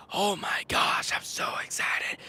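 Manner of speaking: imitating a voice gone hoarse, barely any sound; voiceless croak. Vlogger showing fans his lost post-game voice, voiceless rasp. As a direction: act out having no voice left after cheering. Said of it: "my voice after the game, I was like".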